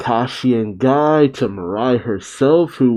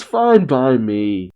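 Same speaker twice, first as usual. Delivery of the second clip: usual speaking voice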